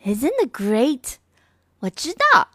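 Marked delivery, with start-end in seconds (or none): 0.0-1.1 slow, lightly acted girl's delight; a hint of a happy little girl; lightly voiced delighted girl. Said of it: "said Ganin".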